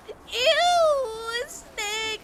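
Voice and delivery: very high, loud crying shriek of disgust. Retold or imitated. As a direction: wail it like a scared girl in tears. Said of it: "she's, like, crying".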